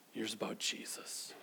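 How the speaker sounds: He hears about Jesus whispered, breathy and low. A narrator's reading. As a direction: lean in and whisper the news. Dramatic whispered repeat.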